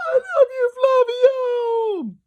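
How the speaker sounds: very high, loud woman's screech. Acted. Mum screeching her love at Flavio, very high and loud. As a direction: shriek it like a starstruck fan. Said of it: "one woman's voice screech".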